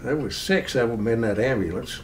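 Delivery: ordinary talk, nothing marked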